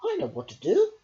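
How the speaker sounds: high, loud excited idea; eager cry. A narrator's reading. Angel Fish offering a cure, eager and high. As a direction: burst out with the answer. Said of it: "cried Angel Fish".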